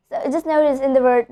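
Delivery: ordinary delivery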